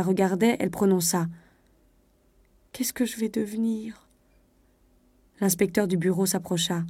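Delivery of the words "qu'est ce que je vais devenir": softer, breath-filled lament; tearful despair. A narrator's reading. Weeping wife alone, wondering aloud what becomes of her, despairing. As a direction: voice the despair half whispered, near tears. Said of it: "elle se mit à pleurer et tout haut".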